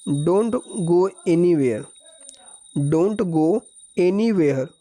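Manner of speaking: very slow, word by word; slow dictation. Shown as a demonstration. Teacher dictating the English line to students, very slow. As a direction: lay each word out slowly. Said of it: "teacher reading the sentence slowly".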